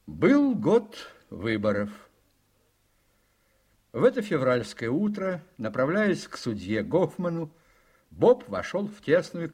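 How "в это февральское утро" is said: ordinary talk, nothing marked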